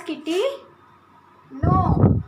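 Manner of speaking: ordinary talk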